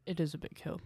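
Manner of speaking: talking while eating; mouth full. Real, not acted. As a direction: speak through a mouthful of food. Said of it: "Sorry, I'm eating".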